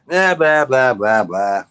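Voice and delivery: dismissive blah blah. Real, not acted. Dismissive mockery.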